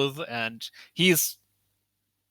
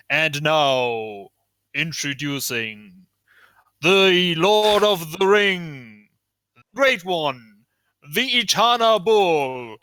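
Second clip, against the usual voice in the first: slow, stretched fighter introduction; wrestling ring announcer, hyped; 5 semitones higher, 6 dB louder, much slower than usual. Acted. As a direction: build to a high, loud, endlessly held name.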